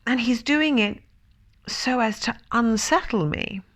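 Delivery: high, agitated complaint; unsettled. A narrator's reading. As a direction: fret with rising alarm. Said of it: "being now completely unsettled".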